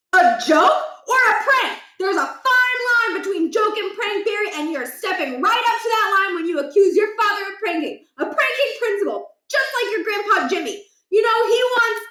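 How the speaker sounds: mock the sleeping son; cranky old father lecturing. Scene: father scolds son for sleeping in, cranky lecture.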